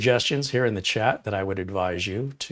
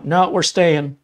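loud, higher stubborn refusal; 7 semitones higher, 9 dB louder, slower than usual. Acted. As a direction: refuse defiantly. Stubborn reply in parable.

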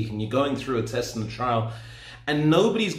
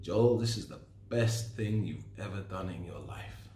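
much softer, lower private whisper; hushed self-talk after the big day; whisper to himself; 6 semitones lower, 9 dB quieter than usual. Retold or imitated. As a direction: breathe it to yourself in awe. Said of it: "I remember saying to myself".

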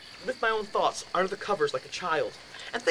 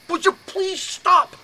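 high, loud, drawn-out plea; exasperated shout; 13 semitones higher, 9 dB louder, slower than usual. Retold or imitated. Man acting out begging roommate to quit predicting death, exasperated shout. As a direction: beg at the top of your voice.

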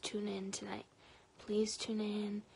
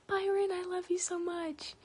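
high, loud burst of affection; sudden high, gushing outburst; affectionate call-out; 9 semitones higher, 7 dB louder than usual. Real, not acted. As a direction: call out your love, pitched way up.

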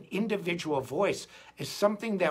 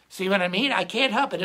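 ordinary delivery

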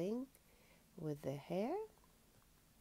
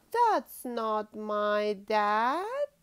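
lively storybook reading aloud; reading to children; 8 dB louder, slower than usual. Acted. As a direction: read it like a bedtime picture book. Reads picture book to child.

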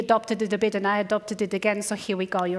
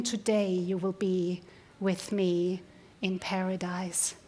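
gentle hushed words from the cross; soft, slow promise; 3 semitones lower, 6 dB quieter, slower than usual. Acted. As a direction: say it softly and slowly, as a dying man offering comfort. Jesus speaks softly from the cross.

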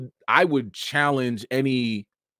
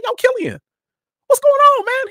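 much higher and louder, hailing him across the room; excited call out; 9 dB louder than usual. Retold or imitated. Fan hailing Cillian Murphy backstage, loud excited greeting. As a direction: shout a buddy-buddy greeting.

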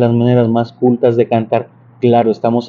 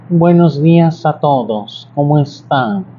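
slow, raised-larynx nasal greeting; 3 semitones higher, slower than usual. Shown as a demonstration. Singing teacher showing a raised-larynx voice. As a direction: lift the larynx and greet slowly. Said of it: "Pero si hablo así, lo que hago es subir la laringe".

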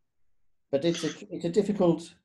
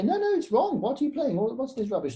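much higher, indignant scolding; mock outrage at a pupil's wrong notes; exasperated teacher; 7 semitones higher than usual. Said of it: "and I'll say".

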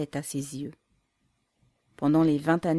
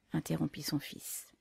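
ordinary delivery, nothing marked

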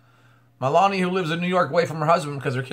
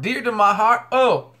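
"Dear to my heart?" higher and louder, rising on "heart"; amazed echo; 6 semitones higher, 5 dB louder than usual. Real, not acted. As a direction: lift "heart" into an amazed question. Echoes the quote in wonder.

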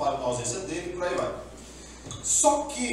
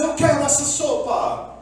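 demanding Esaú; 8 semitones higher, 6 dB louder than usual. Retold or imitated. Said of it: "Esaú falou assim".

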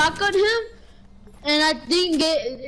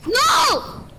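dismayed cry; 9 semitones higher than usual. Real, not acted. Dying in game.

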